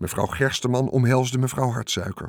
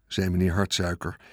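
ordinary delivery, nothing marked

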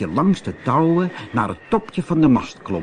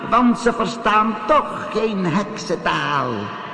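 sea witch casting a spell; 9 semitones higher than usual. A narrator's reading. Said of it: "hoorde ... de zeeheks haar betovering zeggen".

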